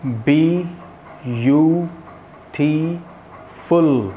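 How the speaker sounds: "बी यू टी फुल" as isolated beats with pauses; slow teacher's count, each of "बी यू टी फुल" standing alone; syllable by syllable. Shown as a demonstration. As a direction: sound out "बी", "यू", "टी", "फुल" one at a time. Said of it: "as I do".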